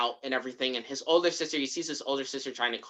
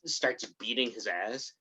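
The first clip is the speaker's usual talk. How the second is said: ordinary talk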